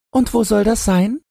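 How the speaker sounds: high squeaky piglet question; very high-pitched pig character; curious little pig. A narrator's reading. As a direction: ask it in a tiny pig voice, rising at the end.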